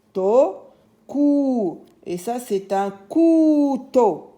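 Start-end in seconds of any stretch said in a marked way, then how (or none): 0.1-1.8 "To" and "cou" high, held and separated; syllable by syllable. Shown as a demonstration. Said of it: "on a la syllabe to". 2.7-4.3 couteau high and slow, long "cou"; stretched syllables. Shown as a demonstration. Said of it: "on a la syllabe cou".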